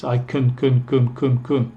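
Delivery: "can" reduced to a short kun; clipped little kun for each "can". Shown as a demonstration. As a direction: repeat "can" as a tiny weak kun. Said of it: "Muy corto".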